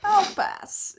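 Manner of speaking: high, loud wincing brush-off; boy dodging a hug dare with a wince; wincing refusal. A narrator's reading. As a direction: back away from the idea with a wince. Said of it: "Izuku winces".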